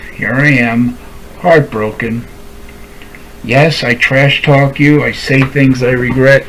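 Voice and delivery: usual speaking voice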